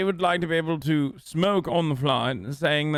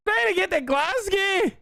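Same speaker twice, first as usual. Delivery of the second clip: mock Scottish woman. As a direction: drag it out in a high mocking voice. Mimicking the woman from the clip.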